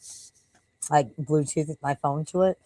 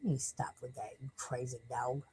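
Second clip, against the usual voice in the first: hushed dog talk; 4 semitones lower, 18 dB quieter than usual. Real, not acted. Murmuring to the dog.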